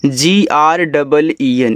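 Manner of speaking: slow, letter by letter; spelling out. Shown as a demonstration. Teacher spelling a word for beginners, slow spelling out. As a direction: spell it out slowly for learners.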